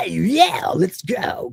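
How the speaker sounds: high hyped-up "Yeah", then a breathy "let's go"; imitating the virus's cocky DJ voice; confident DJ voice. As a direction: hype the crowd like a club DJ. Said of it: "kind of voice that it's got".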